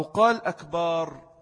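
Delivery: slow أكبر with a long last vowel, akbaar; مد باء أكبر. Shown as a demonstration. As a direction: stretch the A after the B in أكبر. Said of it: "لأن أكبر جمع كبر". («أكبر» بمدّ الباء: أكبار)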